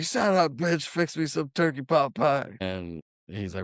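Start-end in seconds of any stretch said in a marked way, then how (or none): 0.0-2.6 abusive father. Retold or imitated. Bender voicing his father to mother.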